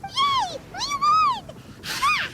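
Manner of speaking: high, loud victory cheer; excited cheer. Acted. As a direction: cheer the win at full joy. Paimon celebrates the win.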